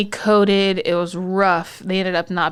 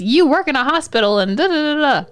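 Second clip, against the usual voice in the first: indignant friend; 7 semitones higher, 3 dB louder than usual. Retold or imitated. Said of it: "people on the other side being like".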